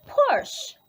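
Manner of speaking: porch? leaps up high; high teasing wrong word. Shown as a demonstration.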